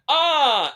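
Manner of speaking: high, loud wordless "ah"; reenacted panicky yelp; frantic fan yell. Fan pointing out a tattoo to a celebrity, frantic yell.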